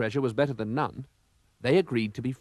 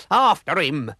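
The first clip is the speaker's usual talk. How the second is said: loud rallying cry; pirate shout; 4 semitones higher, 6 dB louder, slower than usual. A narrator's reading. Pirate captain sends crew after villain, loud shout. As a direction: urge the crew on.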